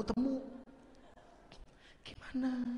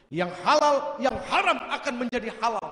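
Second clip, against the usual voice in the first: proclaiming Prophet; 4 semitones higher, 10 dB louder than usual. Retold or imitated. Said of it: "kata Rasul".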